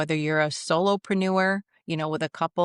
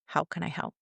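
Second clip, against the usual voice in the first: soft, understated question to an upset man; calm offer; 6 dB quieter than usual. Retold or imitated. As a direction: offer help quietly, without rising to the yelling. Calm reply to a yelling man.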